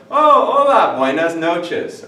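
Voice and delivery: bright high "Oh, hola" then "buenas noches"; cheerful hello demo. Shown as a demonstration. Teacher acting out meeting someone at night, loud and high. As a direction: call out a delighted evening greeting. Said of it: "for hello".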